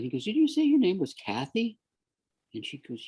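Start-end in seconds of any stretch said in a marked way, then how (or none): 0.2-1.8 surprised question. Retold or imitated. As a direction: pitch jumps up in sudden recognition. Caller realizes who she is.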